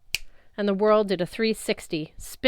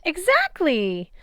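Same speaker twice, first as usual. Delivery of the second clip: high, slow, gushing exclamation; drawn-out delighted cry; gushing goddess; 10 semitones higher, 5 dB louder, much slower than usual. A narrator's reading. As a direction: squeal it out like a thrilled matchmaker.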